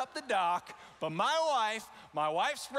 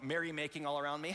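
ordinary talk

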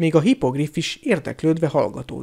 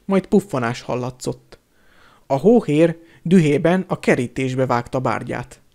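ordinary delivery

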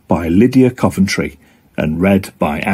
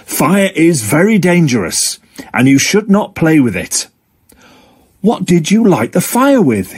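lightly voiced fire dog, mildly stern; a gentle warning to kids, pitched a little higher; Frankie the fire dog; 6 semitones higher than usual. Frankie warns the children.

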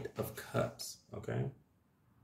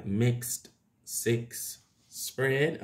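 each word drawn out slowly and deliberately; slow down carefully to avoid getting tongue-tied; much slower than usual. Shown as a demonstration. Tarot reader deliberately slowing down to explain her spread. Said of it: "I always gotta say it slow like that".

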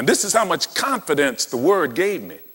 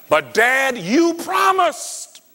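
much higher, slow whiny "you promised"; child pleading; 13 semitones higher, slower than usual. Retold or imitated. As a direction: play the son cornering his dad with a high whine. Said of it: "he comes in and said".

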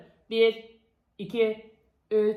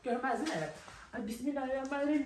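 hushed, softer aside to viewers; whispering while hiding; 3 semitones lower, 13 dB quieter than usual. Real, not acted. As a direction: keep it low while hiding. Hiding under furniture.

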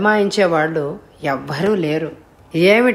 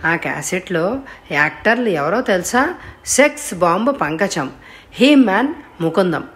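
ordinary delivery

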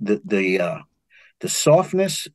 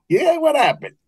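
teasing; 14 semitones higher than usual. Retold or imitated. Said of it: "he's laughing".

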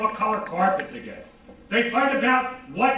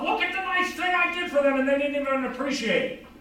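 indignant complaint; 7 semitones higher than usual. Retold or imitated. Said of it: "you can get all pissed off".

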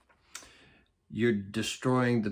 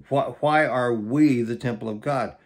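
ordinary talk

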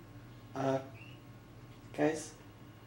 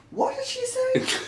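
shocked gasp; slower than usual. Acted. Mock shock at offensive remark.

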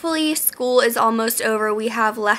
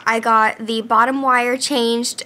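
ordinary talk, nothing marked

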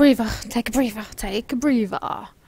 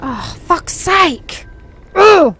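slow, louder exasperated groan of a curse; frustrated outburst; 4 semitones higher, 13 dB louder, slower than usual. Real, not acted. Player frustrated with the horse game, loud exasperated outburst. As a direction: let the frustration boil over. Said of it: "Getting frustrated now".

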